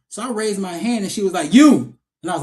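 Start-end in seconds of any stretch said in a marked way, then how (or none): 1.5-2.0 loud, high, punched single word; sudden call-out. Retold or imitated. Acting teacher picks the student who raised a hand, loud call-out. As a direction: imitate a teacher pouncing on a volunteer.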